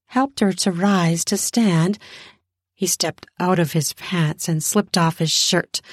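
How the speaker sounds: plain reading voice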